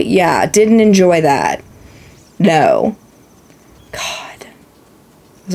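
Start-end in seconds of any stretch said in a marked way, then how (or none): 3.9-5.5 hushed, slow whisper. Real, not acted. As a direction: breathe it out in a whisper. Whispered aside about Deku Tree.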